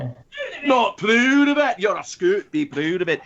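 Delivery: hammed-up Scottish brogue; put-on Scottish exhortation; Scottish accent. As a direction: play the proud Scot, thick mock accent. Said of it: "I wish I was a Scot".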